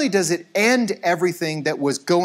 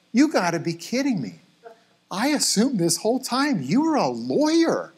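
mock incredulous parent; 6 semitones higher than usual. Acts incredulous parent at banquet.

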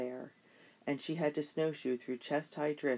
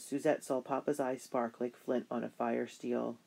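usual speaking voice

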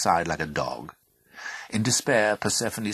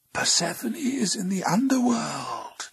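high, airy whispered secret; whispering water. A narrator's reading. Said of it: "whispered the water".

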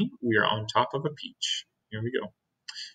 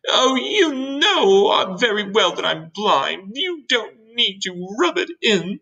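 high, drawn-out wounded protest; offended earthworm; 9 semitones higher, slower than usual. Acted. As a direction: complain bitterly as the touchy earthworm.

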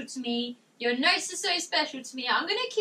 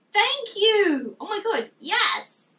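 high, loud burst of thanks; thrilled; 5 semitones higher, 6 dB louder, slower than usual. Real, not acted. Streamer thanking a fan for a membership, thrilled. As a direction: exclaim it with real excitement.

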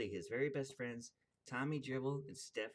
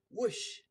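whoosh; 11 semitones higher, 7 dB louder than usual. Acted. Trap door sound effect.

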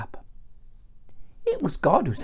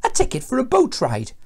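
ordinary delivery

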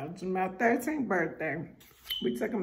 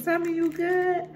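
much higher, louder, upbeat check-in; instructor checking in; 11 semitones higher, 4 dB louder than usual. Retold or imitated. Skydive instructor calling to her mid-fall, upbeat and loud. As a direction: call it out cheerfully to a jumper. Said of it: "He kept doing like this".

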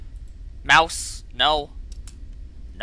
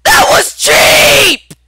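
very high shrieking disbelief; piercingly high, loud outrage; outraged scream; 12 dB louder, much slower than usual. Real, not acted. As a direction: scream foul at the game. Outraged at unfair game death.

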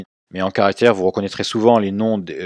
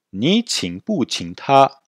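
very slow, higher-pitched Mandarin with drawn-out tones; slow repeat-after-me model; 5 semitones higher, much slower than usual. Shown as a demonstration. Teacher has students repeat the question, slow model. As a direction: stretch each syllable for learners to copy. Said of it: "Répétez".

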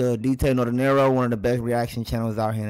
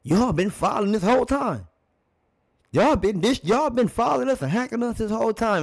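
high mock-accusing voice, pitched way up; imitating Kareem; 10 semitones higher than usual. Reactor voicing Kareem accusing Bird, mock high and indignant. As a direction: play Kareem accusing Bird of hacking him, high and pointed. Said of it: "it kinda looked like to me that he said".

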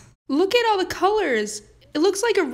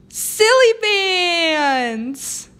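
very slow, stretched and louder; excited drawn-out cheer; 3 semitones higher, 4 dB louder, much slower than usual. Acted. Kid demanding silly bands from mom, stretched and excited. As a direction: savour each word like a prize. Kid finally asks for her treat.

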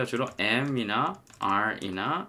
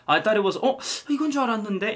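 mock surprise; 11 semitones higher, 3 dB louder than usual. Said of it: "이거가".

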